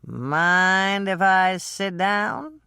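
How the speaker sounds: put-on a drunk man sliding into a scene. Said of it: "stumbling slightly".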